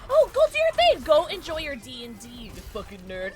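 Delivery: mock insult. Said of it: "I'm joking".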